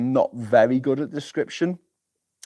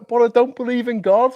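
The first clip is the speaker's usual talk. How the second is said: much higher, rising in disbelief; high incredulous protest; 11 semitones higher, 4 dB louder than usual. Pretend. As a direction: protest it like a question. Mock dialogue, himself as Archbishop.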